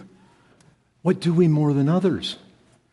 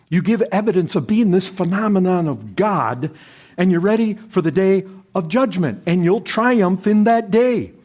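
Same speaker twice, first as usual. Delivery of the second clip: rising, louder, higher preacher's climb; fervent preaching; 7 semitones higher, 5 dB louder than usual. Acted. Preacher urging the brethren toward judgment day, building fervor. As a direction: drive the point home to the church.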